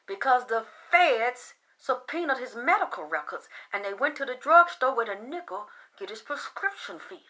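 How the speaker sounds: hushed secretive whisper. Real, not acted. Sharing a secret about the singer's records, whispered. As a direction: lean in and whisper it. Confiding gossip.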